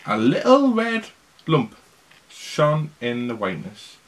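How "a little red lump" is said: usual speaking voice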